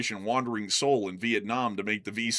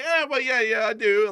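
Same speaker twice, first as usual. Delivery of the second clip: own reply quoted; 10 semitones higher, 5 dB louder than usual. Retold or imitated. His own defensive reply.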